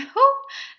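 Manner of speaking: sudden high squeal of a laugh; a teary laugh breaking out high and loud; high burst of laughter. Real, not acted. Emotional laugh mid story.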